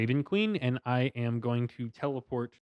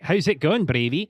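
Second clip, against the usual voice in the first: cheery greeting; 5 semitones higher, 6 dB louder than usual. Acted. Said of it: "Then was like".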